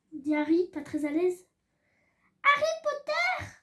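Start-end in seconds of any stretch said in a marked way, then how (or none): 2.4-3.5 high, slow and squeaky little house-elf; a tiny piercing voice that carries through the house; high-pitched elf greeting. A narrator's reading. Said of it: "d'une petite voix aiguë".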